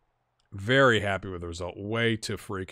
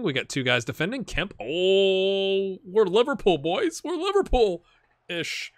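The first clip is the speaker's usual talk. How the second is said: raised, loud running reaction to the match; excited play commentary; 7 semitones higher, 6 dB louder than usual. Real, not acted. Gamer urging his team through a move, excited commentary. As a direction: cheer the players on, voice up.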